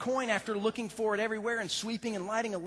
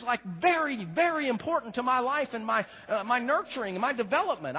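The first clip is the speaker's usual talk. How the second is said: ordinary delivery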